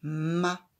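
"Ma." said loud and distinct; teacher giving the answer "Ma." loudly; answer syllable. Shown as a demonstration. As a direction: give the answer "Ma." clearly. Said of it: "Quelle est cette syllabe?".

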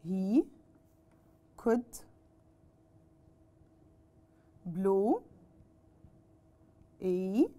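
each word alone, long pauses between; slow dictation. Shown as a demonstration. Teacher dictating to pupils, slow word-by-word delivery. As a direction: dictate it slowly for the class to copy. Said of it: "dictating the sentence word by word".

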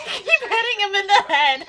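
loud high laughing; amused laughing outburst. Real, not acted. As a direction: laugh through the words. Laughing at tribbles falling.

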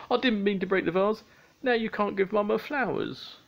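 high-pitched tearful wail, hammed up; sobbing. A narrator's reading. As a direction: wail it like a heartbroken child. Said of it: "sobbed Pingu".

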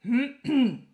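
pompous throat-clear before a speech; loud, self-important ahem. A narrator's reading. Wizard about to give his servant orders, clearing his throat. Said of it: "Er räusperte sich und begann".

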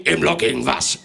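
loud, scolding Father Christmas; a strict Santa telling off the parents; stern Santa. Acted.

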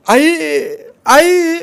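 high, loud tearless wail; crying out. A narrator's reading. Son crying out for his mother, dry wailing. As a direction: cry out for his dead mother. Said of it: "कोरडं रडायला लागला".

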